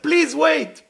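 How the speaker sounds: loud, high shout after someone; acted urgent yell; urgent calling out. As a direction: cry out to stop someone running away. Acted calling after man running away.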